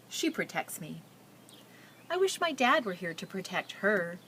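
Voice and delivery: put-on reading a picture book aloud to children; story narration. A narrator's reading. Teacher reading a refugee girl's story to her class, storytelling. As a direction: tell it gently, like reading to a class.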